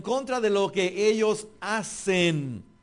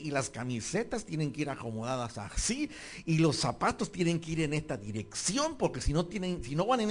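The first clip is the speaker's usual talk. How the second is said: fussy perfectionist; 5 semitones lower, 7 dB quieter than usual. Retold or imitated. Preacher mimics a perfectionist spouse, soft and fussy. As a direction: quietly fuss over every sock like a finicky spouse.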